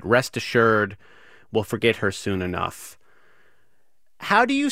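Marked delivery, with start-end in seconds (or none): none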